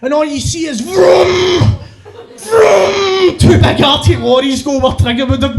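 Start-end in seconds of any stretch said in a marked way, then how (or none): 0.8-3.4 high, drawn-out engine roar; loud imitated vroom of passing traffic; lorry noise. Two lorries hit where Trigger was, roaring vroom.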